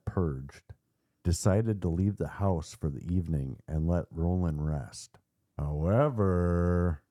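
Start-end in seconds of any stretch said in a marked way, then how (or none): 5.6-7.0 very slow, stretched words, rising high; milk the cliffhanger, dragging each word; drawn-out suspense. A narrator's reading. Narrator teases listeners that the priest erred, drawn-out suspense.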